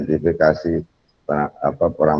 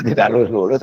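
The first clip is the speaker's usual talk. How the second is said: laughing; 5 semitones higher than usual. Real, not acted. Laughing echo of a joke.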